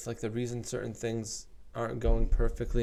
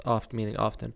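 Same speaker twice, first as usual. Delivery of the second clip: usual speaking voice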